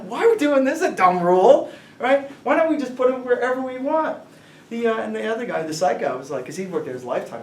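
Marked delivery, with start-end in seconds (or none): 0.0-4.2 high, loud retold complaint; exasperated protest. Employee challenging a coworker over the rules, exasperated. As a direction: protest the rule, louder and higher.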